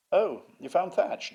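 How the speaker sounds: high, loud mock sneer; David Wilson. Museum head doubting the thatch find, imitated sneering. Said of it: "he is a sneerer".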